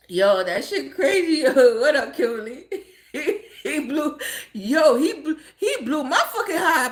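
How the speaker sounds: helpless real laughter breaking up the words; laughing. Real, not acted. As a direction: try to talk while cracking up. Cracking up, restarts through laughter.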